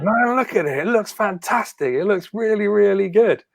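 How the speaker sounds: mock-admiring host voice, pitched high; host showing off. Host unveiling the big box, gushing and high. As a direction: gush over the box like a presenter. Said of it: "host shows Descent box".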